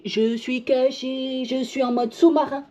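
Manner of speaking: a hint of a playful, sneaky character; hiding dot voice. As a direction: play the little dot that hides like a submarine. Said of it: "Il se met en mode caché".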